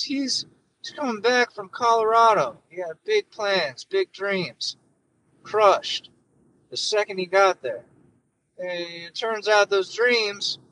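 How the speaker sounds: mock Biden giving a folksy speech; hammed-up Biden addressing folks; Joe Biden impression. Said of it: "shitty Joe Biden impression".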